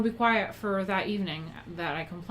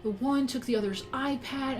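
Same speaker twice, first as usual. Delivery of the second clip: lightly higher, mock apologetic neighbor; mock neighbor excuse; 6 semitones higher than usual. Streamer mocking the neighbor's excuse for her screaming kids, mock apologetic. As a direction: mimic the neighbor's sorry excuses, a bit higher. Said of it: "I have to hear".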